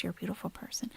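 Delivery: a soft, sincere whisper to a viewer; tender whisper. Real, not acted. Streamer thanks a kind viewer in a tender whisper. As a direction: breathe it gently, almost voiceless.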